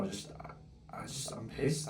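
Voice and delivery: hushed, breathy anger; whispered fury to himself; seething whisper. Real, not acted. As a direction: swallow the rage into a whisper. Fuming over frozen account.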